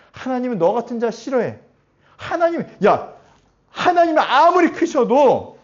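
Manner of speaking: building to a loud, high-pitched taunt; loud acted scolding, pitched high; accusing inner voice. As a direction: hurl the accusation, rising to a shout. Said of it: "그러면서 얘기하죠".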